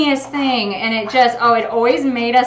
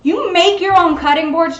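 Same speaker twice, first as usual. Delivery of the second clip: usual speaking voice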